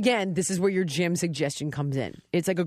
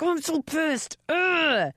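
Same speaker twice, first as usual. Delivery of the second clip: mock anger; 8 semitones higher, much slower than usual. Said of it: "I'm like".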